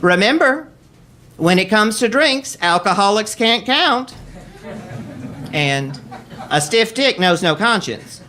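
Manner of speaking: a worldly mother advising her son, half laughing; Mama Jean's motherly wisdom. Retold or imitated. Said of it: "she dispensed her motherly wisdom".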